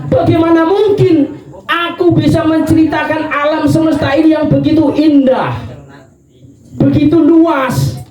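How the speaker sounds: high, impassioned marvelling; fervent wonder. Acted. Badui answering Ali that the vast universe cannot be described, awed. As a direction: preach it with rising awe.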